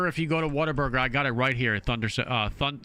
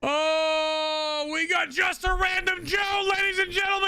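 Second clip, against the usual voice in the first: excited shout; 11 semitones higher, slower than usual. Real, not acted. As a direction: shout the big gift announcement. Hyped shout for big gift.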